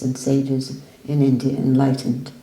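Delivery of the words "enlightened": usual speaking voice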